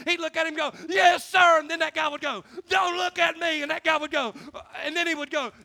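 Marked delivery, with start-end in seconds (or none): 0.9-1.6 loud, high imitation of a recruit; shouted recruit reply. Scared recruit answering his drill instructor, shouted. 2.7-3.6 high-pitched imitation of an instructor's order; yelling drill instructor. Drill instructor ordering a staring recruit, mock yelling. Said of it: "He'd be yelling and screaming".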